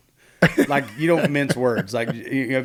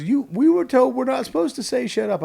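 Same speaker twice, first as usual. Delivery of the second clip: high-pitched tearful protest; mock whimpering kid voice; imitated upset child; 12 semitones higher, 5 dB louder than usual. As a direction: plead like a wounded little kid. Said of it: "he just starts bawling".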